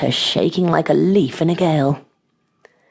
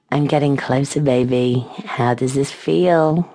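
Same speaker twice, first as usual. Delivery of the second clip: ordinary delivery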